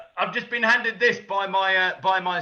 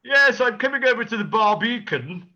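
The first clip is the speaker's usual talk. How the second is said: hammed-up chatty caller, pitched up and loud; mock caller; 3 semitones higher, 5 dB louder than usual. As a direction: put on a big, silly social-call voice. Acting out assistant's internet conversation.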